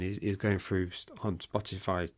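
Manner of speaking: normal talking voice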